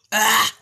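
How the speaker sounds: loud groan of real annoyance; annoyed outburst. Real, not acted. Vlogger failing to find the draft, loud annoyed groan. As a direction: burst out with an exasperated cry. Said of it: "I'm really fucking annoyed".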